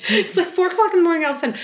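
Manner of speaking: fast, high laughing. Real, not acted. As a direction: rush it out giggling. Laughing at the glove gag.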